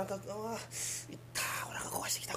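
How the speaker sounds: whispered groans of pain. Acted. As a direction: breathe out the hurt, barely voiced. Stomach pain.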